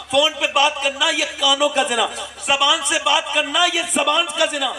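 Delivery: fervent, insistent sermon listing sins; preaching at full force. Acted. Preacher warning the young men, fervent and insistent. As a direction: hammer each point home to the youth.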